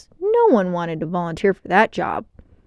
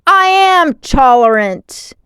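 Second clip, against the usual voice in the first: loud, slow, higher bellow; 7 semitones higher, 10 dB louder, slower than usual. A narrator's reading. Grygon firing at Helix, bellowing in rage. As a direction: roar it in a rage. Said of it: "he bellowed".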